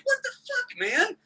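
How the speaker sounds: high disbelieving yelp; shocked. Retold or imitated. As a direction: shriek it in disbelief. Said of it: "You're just like".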